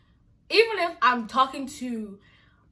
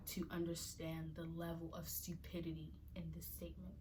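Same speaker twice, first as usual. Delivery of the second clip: whispered aside; 5 semitones lower, 19 dB quieter than usual. Real, not acted. Mocking a moment of silence for the statement, whispered. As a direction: whisper it softly.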